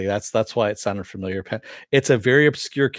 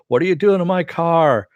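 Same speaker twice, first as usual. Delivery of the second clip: mock drunk shout, higher and louder; retold drunk yell; 7 semitones higher, 5 dB louder than usual. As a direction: imitate a drunk Santa yelling at a thief. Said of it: "screaming at her and go".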